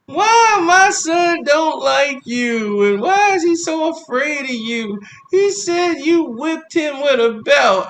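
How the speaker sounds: high, slow accusing voice; accusing mother. Retold or imitated. Mother confronting the boyfriend, high and slow. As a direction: confront him about the belt. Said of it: "And she".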